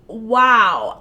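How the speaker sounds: usual speaking voice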